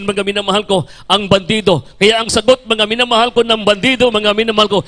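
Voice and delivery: ordinary talk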